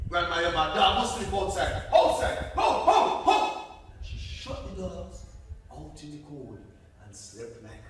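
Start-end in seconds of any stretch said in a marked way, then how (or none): 0.1-3.4 much higher and louder, gloating and cackling; mocking laugh. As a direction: laugh them out the door. Buddy mocks dogs outside.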